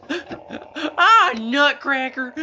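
high, loud yell breaking into a laugh; scream while laughing. Retold or imitated. Goofing on the beach with a friend, laughing scream. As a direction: shout it as a giggly fake swear. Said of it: "I just scream".

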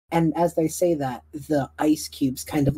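ordinary talk, nothing marked

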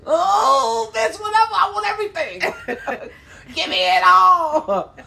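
very high, gushing mock squeal; swooning excitement. Customer melting for the Popeyes ladies, swooning excitement. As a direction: play utterly won over, stretching the words out high.

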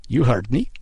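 usual speaking voice

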